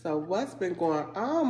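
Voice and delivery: usual speaking voice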